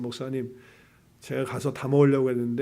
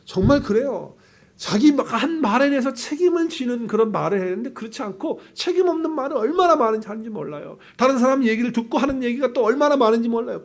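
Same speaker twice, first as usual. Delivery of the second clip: higher, louder, driving preacher voice; fervent preaching; 9 semitones higher, 5 dB louder than usual. Acted. Preacher warns the congregation about careless words, raised and insistent. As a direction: press the point hard to the congregation.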